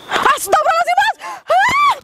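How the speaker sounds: high, loud panicked cry of God's name; startled scream of fright; frightened shriek. Real, not acted. Startled by the pads raining down.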